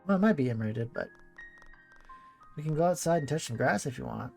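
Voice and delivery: usual speaking voice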